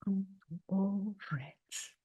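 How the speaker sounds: usual speaking voice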